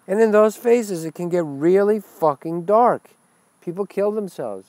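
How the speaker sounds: ordinary talk, nothing marked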